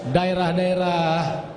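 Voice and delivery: ordinary delivery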